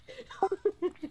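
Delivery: sudden high laugh; high burst of laughter. Real, not acted. Laughs after the chase.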